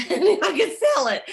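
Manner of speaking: amused at her own naive younger self; laughing. Real, not acted. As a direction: laugh through the punchline.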